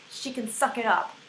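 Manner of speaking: usual speaking voice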